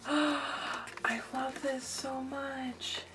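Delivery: slow, gasping whisper of delight; awed whisper. Real, not acted. Crafter admiring a friend's handmade card, awed whisper. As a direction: whisper it slowly, overwhelmed.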